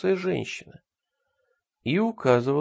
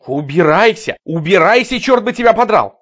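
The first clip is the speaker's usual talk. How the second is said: angry shout; 7 semitones higher, 12 dB louder than usual. Acted. Cashier chasing off madwoman.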